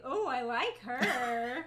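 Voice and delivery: slow, loud, drawn-out "Oh, I like her"; imitating drawn-out swoon. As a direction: mimic a dreamy smitten drawl. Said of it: "you were like".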